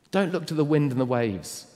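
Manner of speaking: ringing pulpit plea; urgent exhortation. Acted. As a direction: urge them away from the storm. Urgent exhortation then quiet reprise.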